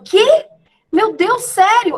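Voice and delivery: high, loud shocked gasp of disbelief; shocked reply. Retold or imitated. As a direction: blurt it out as if just hit by bad news. Acting out the meme's shocked reply.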